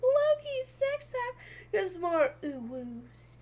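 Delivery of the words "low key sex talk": high mocking echo of the chat line; teasing high-pitched repeat. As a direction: parrot it back in a silly high voice.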